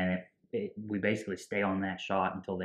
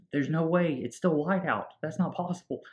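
high-pitched disbelief, imitating panic; mock freak-out; 9 semitones higher than usual. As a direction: retell it as a panicked protest, voice raised. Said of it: "Mark is freaking out".

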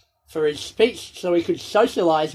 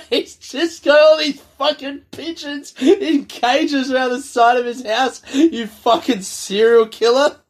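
laughing; 5 semitones higher, 6 dB louder than usual. Real, not acted. Mocking friend's pigeons.

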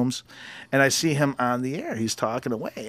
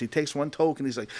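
ordinary talk, nothing marked

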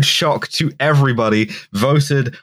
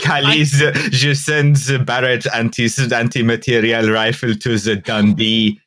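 put-on Quebecois French accent. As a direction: play a Quebecois separatist arms supplier. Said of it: "rich Quebecois guys".

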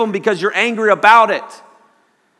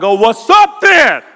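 loud, higher, drawn-out mock challenge; challenging shout; 5 semitones higher, 8 dB louder, slower than usual. Speaker facing the monster's father, drawn-out loud challenge. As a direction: stretch out a loud, slow challenge.

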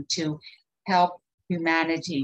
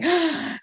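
frightened gasp; 9 semitones higher than usual. Retold or imitated. Dorothy frightened by the wizard.

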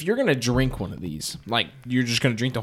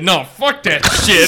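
imitating Scott, hammed-up brash and a bit louder; mock brash outburst; 6 semitones higher, 7 dB louder, slower than usual. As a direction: toss it off like a cocky host barging in, slower. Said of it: "and then all of a sudden Scott said".

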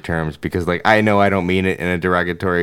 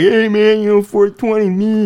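much higher, louder mellow stoner voice; happy stoner; 12 semitones higher, 4 dB louder than usual. Retold or imitated. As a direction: toss it off like a blissed-out stoner. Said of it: "stoners were just, like, happy".

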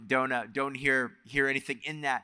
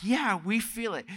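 higher, mock-eager congregation reply; playing along; 9 semitones higher than usual. Parent as audience to five-year-old preacher, mock-eager. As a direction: humor the little preacher with a lifted voice.

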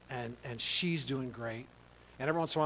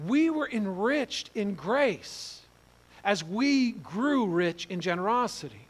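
preaching swell; 6 semitones higher, 5 dB louder, slower than usual. Acted. Preacher builds to climax.

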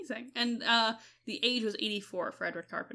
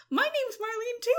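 quoted fan; 13 semitones higher, 5 dB louder than usual. Retold or imitated. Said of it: "someone's like".

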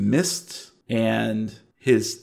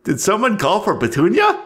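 put-on high, quick, ghoulish old-man voice; fanged old man; 10 semitones higher, 4 dB louder, faster than usual. Fanged old man greets the intruders, high and quick. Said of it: "and he says".

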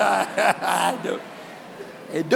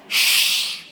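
whispered hush; shushing. Acted. Hushing the crowd.

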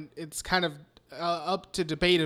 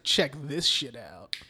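acted quote; 6 semitones lower, 4 dB quieter, slower than usual. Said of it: "she's like".

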